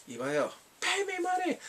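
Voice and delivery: very high, put-on demanding voice; mock indignant demand. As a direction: demand payment in a squeal. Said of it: "the whole thing's like".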